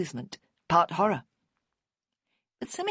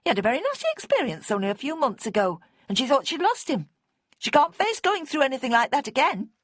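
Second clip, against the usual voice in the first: high, loud, fast defence; agitated outburst; 9 semitones higher, 4 dB louder than usual. A narrator's reading. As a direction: defend him high and urgent. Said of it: "Bonnie burst out".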